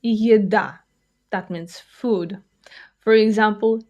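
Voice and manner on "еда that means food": ordinary talk, nothing marked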